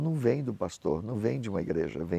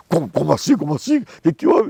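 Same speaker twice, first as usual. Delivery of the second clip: louder, higher startled protest; 6 semitones higher, 10 dB louder than usual. Retold or imitated. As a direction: act caught off guard, rising. Pastor reage surpreso à pergunta. (pastor surpreso, mais alto e agudo)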